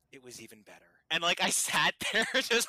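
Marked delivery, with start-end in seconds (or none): 0.1-0.9 hushed, after a long pause; a low, hushed confession; quiet reverent reply. Retold or imitated. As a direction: let it land softly. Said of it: "and then said".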